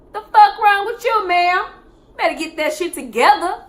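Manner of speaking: loud, high scolding; angry confrontation. Retold or imitated. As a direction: snap at her loud and high. Confronting the resident.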